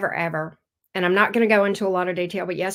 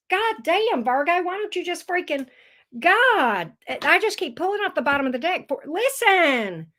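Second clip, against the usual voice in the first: higher, fast flustered excitement; tripping over words with real excitement; so excited; 9 semitones higher than usual. Real, not acted. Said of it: "I'm so excited I can't even read the damn cards".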